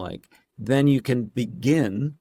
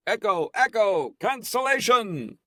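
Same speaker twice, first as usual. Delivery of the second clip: much higher, drawn-out playful echo test; mock echo call; 12 semitones higher, slower than usual. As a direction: sing out the words like into a canyon. Testing echo alone on call.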